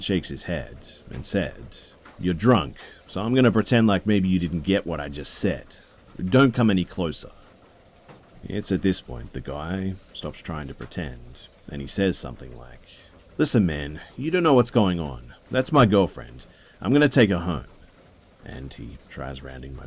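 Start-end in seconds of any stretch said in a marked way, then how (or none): 2.2-7.3 louder, stern and higher; firm warning. Retold or imitated. Boyfriend confronts the drunk man. 13.4-17.7 much louder and higher, heated; angry drunk. Retold or imitated. Drunk man argues, getting angry.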